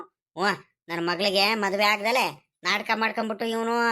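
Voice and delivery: grumble, sulking at the son-in-law; grumbling old uncle. Acted. Actor voices grumbling old uncle.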